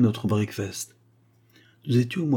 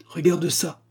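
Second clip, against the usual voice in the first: excited call; 7 semitones higher, slower than usual. A narrator's reading. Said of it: "s'écria-t-il".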